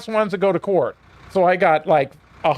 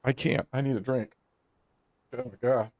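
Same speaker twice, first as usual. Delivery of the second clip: still angry; 6 semitones lower, 6 dB quieter than usual. Real, not acted. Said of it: "it makes me so mad now".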